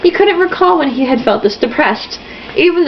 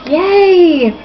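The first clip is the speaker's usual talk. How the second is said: mock cheer; 8 semitones higher than usual. Real, not acted. Reader cheers sarcastically.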